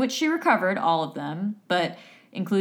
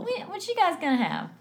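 put-on server voice, pitched way up; high waitress voice; 11 semitones higher, 3 dB quieter than usual. Co-host playing waitress taking an order, high put-on voice. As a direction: imitate a waitress in a high register. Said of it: "in the register".

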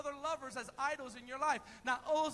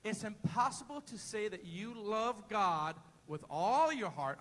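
said slowly; 7 semitones lower than usual. Shown as a demonstration. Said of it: "I'm gonna say that real slow".

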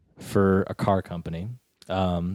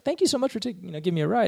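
put-on much higher girly voice; a sweet high thank-you; lightly voiced girl; girl's voice; 12 semitones higher, much faster than usual. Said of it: "she's like".

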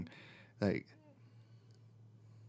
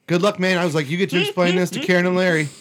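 teasing; 7 semitones higher, 7 dB louder than usual. Retold or imitated. Teasing bandmate about dog.

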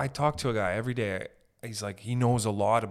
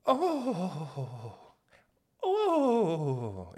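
mock ghostly moan; 7 semitones higher than usual.

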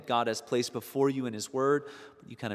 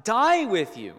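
much higher and louder protest; emphatic; 9 semitones higher, 6 dB louder than usual. Retold or imitated. Peter protesting to Jesus, loud emphatic oath. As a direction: swear it with full force. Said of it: "he said emphatically".

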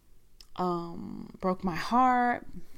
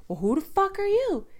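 raised, high-pitched challenge; retold indignant comeback; 12 semitones higher, 5 dB louder than usual. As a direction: imitate someone squaring up to an intruder. Retold confrontation between two men.

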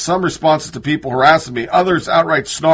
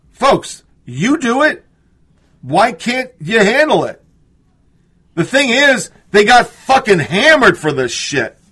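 rising incredulous outburst, higher; real exasperation, pitched up; exasperated; 5 semitones higher, slower than usual. Real, not acted. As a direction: demand an answer from the press, building up. Exasperated rant at the press.

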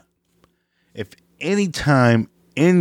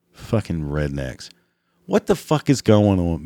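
ordinary delivery

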